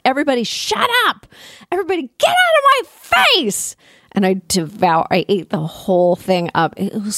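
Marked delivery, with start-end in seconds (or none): 0.0-3.8 high, fast, hammed-up yell; mock yell. Reader telling everyone to leave her alone while reading, in a high mock yell. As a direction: shoo everyone away in a playful shriek. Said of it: "I was like".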